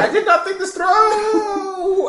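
high, loud, drawn-out cry of regret; hammed-up wail. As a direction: wail it slowly as you topple over. Character wails after a failed kick.

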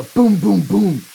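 loud, high booms punched out; big emphatic sound-effect boom; booming door knocks. Acted. Game master voices pounding on door.

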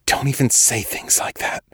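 unvoiced hushed plea; frightened whisper. A narrator's reading. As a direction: keep it a scared whisper.

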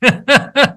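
high, bright burst of laughter; real laugh. Real, not acted. Laughing at how his wife scared him, real laugh.

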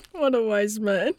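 real laughter around a slow remark; laugh, draw the words out, laugh; amused laughing. Real, not acted. Host reacting to guest's story about her husband, laughing.

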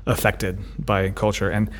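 ordinary talk, nothing marked

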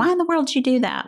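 quick, high exasperated why; mock exasperated parent question. Mom imitating an annoyed parent to a toddler, exasperated. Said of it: "ask 'em silly questions like".

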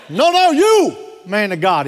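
high, loud call in a mock South African accent; imitated South African accent. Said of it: "He had that accent".

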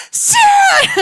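a loud, high cry for help; panicked call. Retold or imitated. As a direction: yell the name as if you're stuck. Friend calls for help, trapped.

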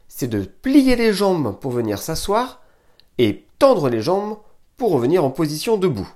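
usual speaking voice

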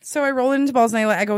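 normal talking voice

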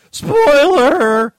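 loud, high yelp; shouted warning. Real, not acted. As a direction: yell it as a warning.

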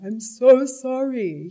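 high, loud, slow tearful plea; new mother apologising to her baby, loud and tearful; tearful apology. Retold or imitated. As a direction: sob out an apology to the newborn. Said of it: "crying and going".

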